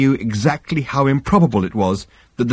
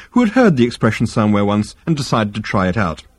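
ordinary delivery

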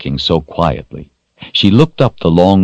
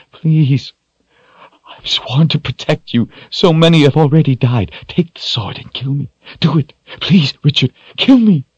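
desperate, high-pitched begging; sobbing plea; 11 semitones higher, 4 dB louder than usual. A narrator's reading. Woman begs Richard to take the sword, sobbing plea. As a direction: beg tearfully, rising to a loud peak. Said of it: "she sobbed".

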